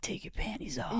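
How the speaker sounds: a guy's seductive voice; sexy voice. Retold or imitated. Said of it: "a sexy voice".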